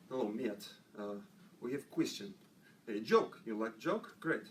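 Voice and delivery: low-pitched Russian joke-teller; Russian accent. Shown as a demonstration. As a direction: tell it in broken English with a Russian accent. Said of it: "I got a Russian".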